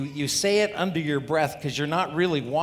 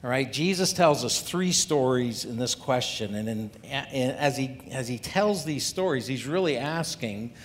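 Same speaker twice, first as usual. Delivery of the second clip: usual speaking voice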